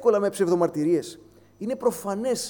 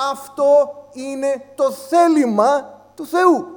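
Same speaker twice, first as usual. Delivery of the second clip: slow, louder and higher, stress on Θεού; emphatic proclamation; 7 semitones higher, 9 dB louder, slower than usual. Acted. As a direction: drive the words home from the pulpit. Repeating the verse's key phrase. (αργά και δυνατά, έμφαση στο «Θεού»)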